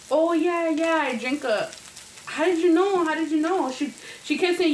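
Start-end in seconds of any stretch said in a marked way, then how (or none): 0.1-3.7 eager. Retold or imitated. Retells own eager reply to waxer.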